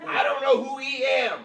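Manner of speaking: high, loud, slow mocking repeat; mock indignant Gary. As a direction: hammer home the silly grammar. Repeating Gary's odd reply.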